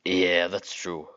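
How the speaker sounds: slow, drawn-out agreement at ordinary pitch; wrong character's voice. Acted. Reader answers in the wrong character's voice, slow and unraised. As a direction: agree slowly, pitch kept down. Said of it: "Whose voice is that?".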